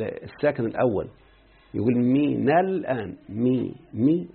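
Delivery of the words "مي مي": مي said very slowly; isolated syllable. Shown as a demonstration. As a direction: drag out مي twice. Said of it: "وهنا حركها بالفتحة". ("مي" بمدّ بطيء)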